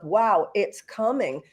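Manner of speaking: normal talking voice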